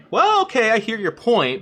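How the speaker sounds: very high, loud put-on voice of a reasonable viewer; prissy polite chatter. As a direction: squeak it like a primly polite viewer.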